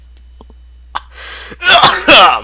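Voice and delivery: loud high villain laughter falling away; hammed-up maniacal laugh; evil cackle. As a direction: cackle then let it fall.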